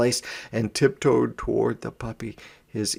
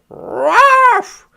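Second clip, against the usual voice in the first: high, loud yappy puppy bark; 11 dB louder than usual. A narrator's reading. Narrator barks as the puppy facing the kitten. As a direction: bark sharply like a small puppy. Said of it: "Suddenly he barked".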